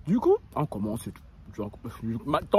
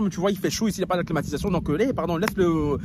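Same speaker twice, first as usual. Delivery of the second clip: ordinary talk, nothing marked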